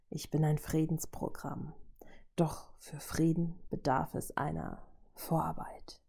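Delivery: soft, measured villain voice; robot villain, quiet menace. A narrator's reading. Robot explaining peace needs groundwork, quiet menace. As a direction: deliver it hushed and deliberate. Ultron states his menacing plan.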